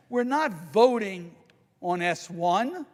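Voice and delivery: "not" 내리꽂듯 강조하고 천천히; slow and deliberate, landing hard on "not"; stress on NOT. Real, not acted. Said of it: "Let me repeat that".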